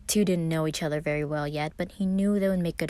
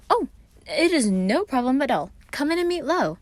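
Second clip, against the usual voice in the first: high, loud theatrical welcome; welcoming Roman; 9 semitones higher, 6 dB louder than usual. A narrator's reading. Roman welcoming Virgil inside, high and loud. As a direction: sweep him in grandly.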